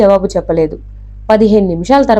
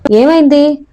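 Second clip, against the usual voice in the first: higher-pitched, mildly impatient question; 6 semitones higher, slower than usual. A narrator's reading. Detective cutting into the inspector's account, mildly impatient. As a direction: press for the answer with some impatience. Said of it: "అడిగాడు యుగంధర్ అసహనంతో".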